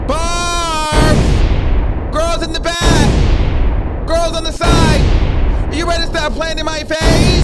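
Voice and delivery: rally the whole chat, loud and high; hype shout. Real, not acted. Hyping up the viewers, calling out.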